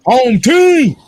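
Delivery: loud hype greeting; calling out. Real, not acted. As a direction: call it out to the room.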